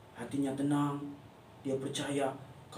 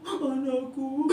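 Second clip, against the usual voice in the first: sad sigh; 4 semitones higher, much slower than usual. Acted. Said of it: "Ia sedih sekali".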